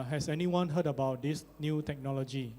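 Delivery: ordinary delivery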